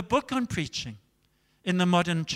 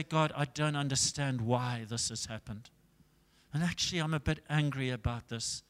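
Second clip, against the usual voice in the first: low, hushed confession to God; soft, subdued admission; honest quiet prayer; 5 semitones lower, 8 dB quieter than usual. Acted. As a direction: confide it softly, as in private prayer.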